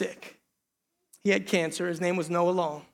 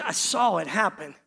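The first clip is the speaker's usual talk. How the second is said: ordinary talk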